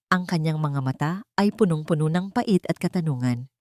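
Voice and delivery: usual speaking voice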